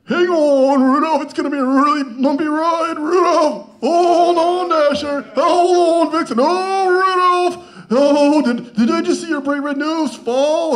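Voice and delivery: high, loud frantic Santa shouting to reindeer; Santa Claus. Acted. Santa Claus yelling to his reindeer on a roller coaster, frantic and loud. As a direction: Santa losing it on a bumpy ride. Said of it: "All right, Santa Claus. Here goes".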